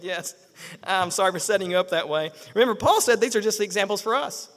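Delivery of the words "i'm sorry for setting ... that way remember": ordinary delivery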